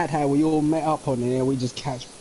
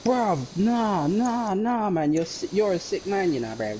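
high, disgusted mates' voice; mock disgusted friend; 7 semitones higher than usual. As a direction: recoil like a grossed-out friend. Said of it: "it was all like".

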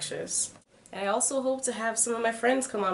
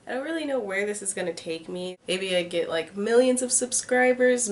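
normal talking voice

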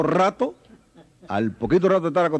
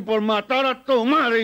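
angry policeman; 7 semitones higher, much slower than usual. Acted. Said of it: "el policía le entra a patada".

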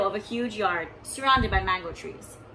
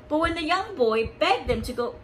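ordinary delivery

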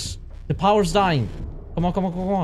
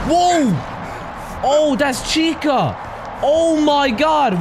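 startled shout; 8 semitones higher, slower than usual. Real, not acted. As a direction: shout out at a sudden scare. Chica jumpscare appears.

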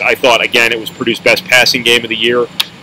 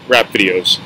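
ordinary talk

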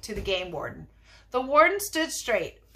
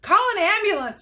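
high, loud cry for help; screaming; 9 semitones higher, 6 dB louder than usual. A narrator's reading. Lady in the crowd cries for help, screaming. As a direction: call out urgently in a raised voice. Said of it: "screamed a lady in the crowd".

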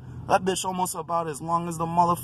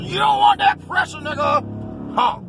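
much higher, loud taunting shout; sudden yell; 13 semitones higher, 4 dB louder than usual. Real, not acted. Yelling at the driver beside him, high and loud. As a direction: bellow the taunt at him out the window.

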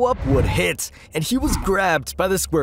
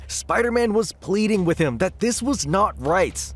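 ordinary delivery, nothing marked

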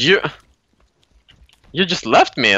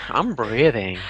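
calm and quieter; 5 dB quieter than usual. Shown as a demonstration. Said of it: "take a breather".

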